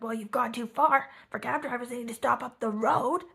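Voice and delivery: loud, indignant imitation of a telling-off; Frankie. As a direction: shout it at a driver, mock-angry. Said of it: "Frankie's, like, still shouting at him".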